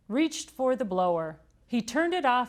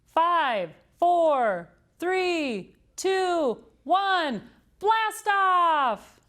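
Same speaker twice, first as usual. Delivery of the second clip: rising, louder and higher to blast off; excited countdown; 10 semitones higher, 5 dB louder, much slower than usual. A narrator's reading. Amelia Bedelia launching her bonnet, excited countdown. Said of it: "Amelia Bedelia counted down".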